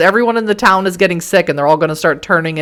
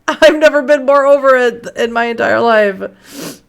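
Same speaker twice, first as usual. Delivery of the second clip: ordinary delivery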